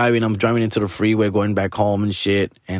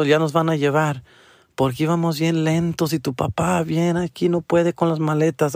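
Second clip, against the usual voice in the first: higher put-on mom voice, rambling; imitating mom on phone; 6 semitones higher than usual. Son imitates mom explaining they're still on the bridge, higher voice.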